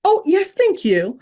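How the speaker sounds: high, drawn-out mock delight; playful, slow oh-yes-please; delighted acceptance. Eagerly accepts powder at a party.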